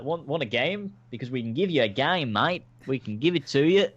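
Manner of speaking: mock Aussie bloke offering a game; hammed-up Australian mate voice; Australian accent. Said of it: "That's me pretending to be Australian".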